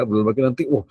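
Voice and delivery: ordinary delivery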